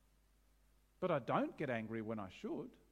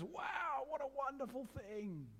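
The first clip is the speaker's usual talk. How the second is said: mock admiration; 10 semitones higher, 5 dB quieter, slower than usual. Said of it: "our culture says".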